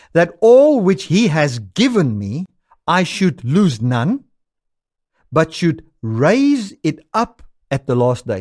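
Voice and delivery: slow, weighty reading of the verse; scripture reading. Acted. Preacher reading John to the congregation, slow and solemn. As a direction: lean into each word of the promise.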